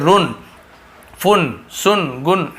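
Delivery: "रुन, फुन, सुन, गुन" slowly with oo vowels; wrong pronunciation. Shown as a demonstration. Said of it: "ऐसे पढ़ते हो जाता गलत".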